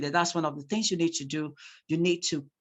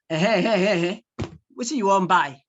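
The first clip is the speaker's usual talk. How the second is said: loud, brusque market trader; rude market woman; 2 semitones higher, 6 dB louder, faster than usual. Shown as a demonstration. Teacher playing a market woman confronting a customer, rude market woman. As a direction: challenge the customer rudely, louder. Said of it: "if it is a rude".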